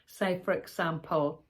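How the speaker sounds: normal talking voice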